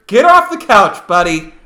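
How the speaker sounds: loud, high mock call. To Brendan Fraser, urging a comeback with a loud, high call. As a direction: yell it up at a lazy star.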